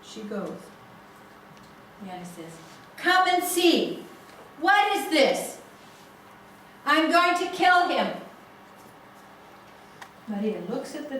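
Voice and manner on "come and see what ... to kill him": furious. Retold or imitated. Angry father over damaged dossier.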